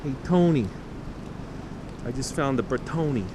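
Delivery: light mock Italian to a friend; hint of an Italian accent. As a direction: call your buddy Tony Italian style. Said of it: "do the Italian accent".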